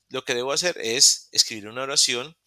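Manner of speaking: ordinary talk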